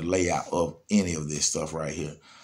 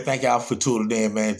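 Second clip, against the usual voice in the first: normal talking voice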